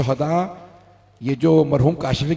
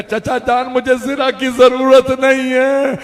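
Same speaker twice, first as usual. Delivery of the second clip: higher, slow and rising to a plea; a boy begging his uncle to let him go; young Qasim pleading; 7 semitones higher, 5 dB louder than usual. Acted. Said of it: "कहा".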